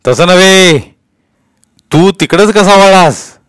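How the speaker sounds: loud, high, drawn-out question; forceful police interrogation; impatient inspector. A narrator's reading. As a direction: cut in and press the boy. Inspector corrects and presses boy.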